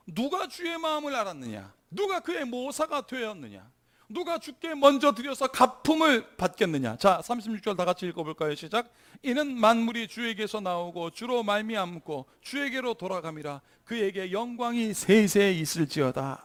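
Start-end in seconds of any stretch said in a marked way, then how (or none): 0.1-7.0 higher, forceful preacher's declamation; emphatic scripture reading. Acted. Reads scripture aloud in preaching. 9.2-16.4 loud, declamatory verse reading; congregational scripture reading. Acted.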